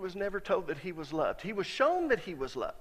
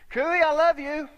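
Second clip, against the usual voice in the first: louder, higher, slow I love you; earnest declaration; 8 semitones higher, 10 dB louder than usual. Retold or imitated. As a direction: say it out boldly across the room. Preacher tells father-in-law he loves him.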